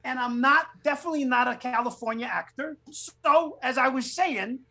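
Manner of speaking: high, over-the-top New York cop. Retold or imitated. Actor denying he is Californian, exaggerated New York accent. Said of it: "every New York stereotype I could come up with".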